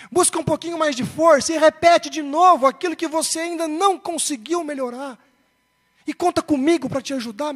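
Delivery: put-on rally your child with forceful, loving insistence; urgent encouragement. Father urges his son on.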